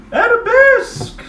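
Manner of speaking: very high, loud, slow command; calling out. Acted. Teacher calls the step across the studio. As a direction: stretch the word out grandly.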